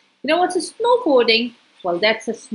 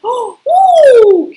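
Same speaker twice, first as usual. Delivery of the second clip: loud, high gasp of amazement; amazed ooh; 12 semitones higher, 11 dB louder than usual. Real, not acted. Reader marvelling at Pingu's jump, loud high ooh. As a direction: gasp as if watching it live.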